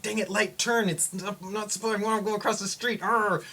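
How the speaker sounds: high-pitched frustrated rant, imitated; mock anger. Imagining the man angry at the traffic light, mock frustration. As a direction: grumble in a put-on impatient voice, ending in a growl. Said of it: "maybe he's really angry".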